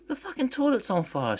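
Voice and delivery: high, loud panicked shriek; panicked scream. Acted. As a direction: scream it in terror. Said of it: "she screamed".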